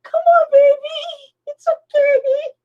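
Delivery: high sweet pleading call; coaxing the cat. Retold or imitated. Owner sweet-talks her cat Ronnie in the rain, high. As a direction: coax the cat in gently. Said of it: "I'm still standing out there going".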